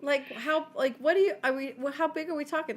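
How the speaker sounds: panicked mom asking how big; incredulous. Retold or imitated. Mom reacting to Coliseum.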